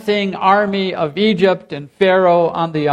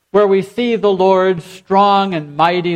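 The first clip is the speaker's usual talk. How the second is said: ordinary talk, nothing marked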